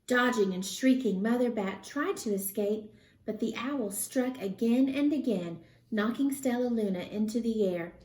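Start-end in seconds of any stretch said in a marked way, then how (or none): none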